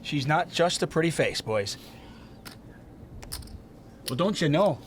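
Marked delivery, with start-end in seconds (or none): 4.1-4.8 put-on a Minnesota accent on request; folksy Minnesota lilt, playing it up. Asked by co-host, does a Minnesota accent. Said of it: "What is your best Minnesota accent?".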